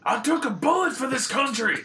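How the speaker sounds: loud, very high-pitched yelling; mock outraged shout. Outraged customer yelling at a checkout machine, mock outraged shout. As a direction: holler it in over-the-top indignation.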